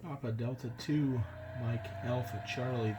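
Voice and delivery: ordinary delivery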